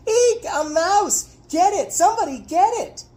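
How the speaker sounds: very high, loud mock shriek; hammed-up falsetto panic, mocking a scared dad; screaming old woman. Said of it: "screaming like an old woman".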